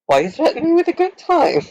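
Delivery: high, teasing sing-song; playful mock. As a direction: tease them right back, way up high. Mock reply to the insult.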